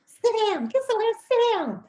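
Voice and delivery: high, loud mock scolding mom. Mother telling rowdy kids to sit down, loud and high. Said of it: "you just be like".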